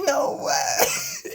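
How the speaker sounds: high, loud disbelieving shout; amazed outburst. Retold or imitated. Caller reacting to coworker's confession, high amazed shout.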